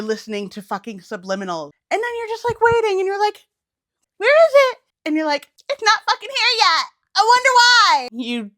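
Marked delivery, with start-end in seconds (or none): 1.9-8.1 high, loud mocking voice of an impatient manifester; mock whiny manifester. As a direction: whine like someone waiting on the universe.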